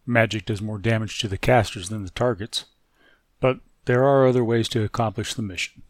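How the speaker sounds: loud, punchy intro over music; show intro read. Acted. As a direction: announce the mission with force. Reads the show's intro.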